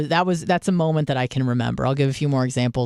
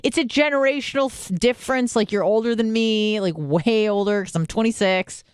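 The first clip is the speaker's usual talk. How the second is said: indignant protest; 6 semitones higher than usual. Retold or imitated. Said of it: "'cause I'm like".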